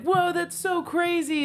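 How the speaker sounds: high, wowed quote; amazed. Retold or imitated. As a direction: imitate two people marveling at a coincidence. Retold shared amazement at coincidence.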